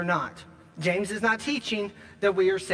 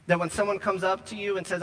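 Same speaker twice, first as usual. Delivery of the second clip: ordinary delivery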